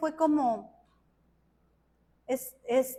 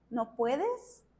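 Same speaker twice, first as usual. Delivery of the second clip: gentle questioning; 3 semitones lower, 7 dB quieter than usual. Retold or imitated. God's gentle question answering her prayer.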